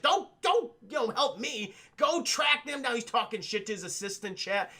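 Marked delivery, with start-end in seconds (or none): 0.0-2.9 high, loud angry outburst, imitating a villain; pissed Robotnik. Robotnik yelling at his agents, high and angry. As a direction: bark orders like a furious villain. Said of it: "Robotnik's just fucking pissed".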